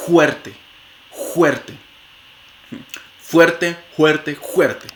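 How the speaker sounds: "fuerte" with a scraping back-of-throat j; velar j for f. Shown as a demonstration. Teacher showing the velar version of fuerte. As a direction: rasp the f of "fuerte" as a Spanish jota. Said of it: "una fricativa velar sorda".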